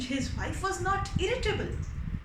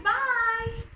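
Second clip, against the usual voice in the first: fisherman's goodbye; 6 semitones higher, 4 dB louder than usual. Acted. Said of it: "he said".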